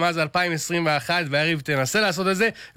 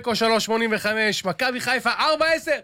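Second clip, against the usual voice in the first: higher, energized odds read; raised voice; 4 semitones higher than usual. Real, not acted. Betting segment reader told by host to raise his voice, raised voice. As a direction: lift the voice into a high finish. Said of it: "תרים את הקול".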